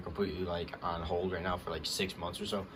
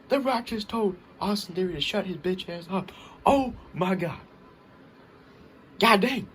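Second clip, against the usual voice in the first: higher and louder, real hype; excited; 11 semitones higher, 5 dB louder than usual. Real, not acted. Fan raving about The Rock's insult, excited. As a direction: rave about it, can't get over it. Still reeling from the moment.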